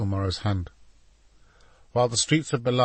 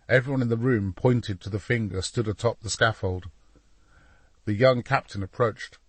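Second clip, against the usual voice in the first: plain reading voice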